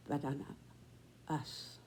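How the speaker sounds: normal talking voice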